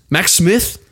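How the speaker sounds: high, cornered and backpedalling; husband caught out by his wife, high and nervous; Rudy's high voice. Acted. Rudy reacts to name Max.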